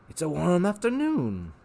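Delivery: eager, wheedling showman; a salesman tempting the boy; Rictus voice. Acted. Rictus tempting Harvey with pets, eager showman voice.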